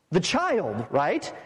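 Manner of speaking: ordinary delivery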